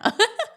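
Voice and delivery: short high laugh, loud; high burst of laughter. Real, not acted. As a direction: laugh at your own hype. Laughing at her own hype.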